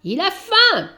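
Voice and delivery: loud, high call; cat shouts over the baby's crying; shouting through paws. Acted. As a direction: cup your hands and shout it. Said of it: "en mettant ses pattes en porte-voix".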